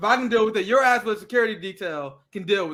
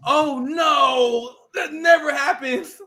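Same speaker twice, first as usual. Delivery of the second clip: hammed-up sarcastic oh no, drawn out slowly; sarcastic, slow mock alarm at routine news; 4 semitones higher, 4 dB louder, much slower than usual. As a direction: fake shock, slow and dripping with sarcasm.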